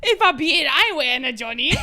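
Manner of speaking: high, loud British; mock British accent. Host riffing for laughs, high loud British accent. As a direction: belt out the line in exaggerated British. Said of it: "Let's hear a British accent".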